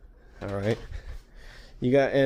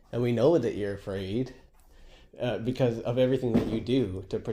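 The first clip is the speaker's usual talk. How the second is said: ordinary delivery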